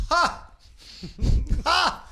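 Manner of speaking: sharp high mock laugh. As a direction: scoff at them with a high bark of laughter. Mocking laugh after quote.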